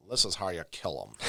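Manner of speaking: ordinary delivery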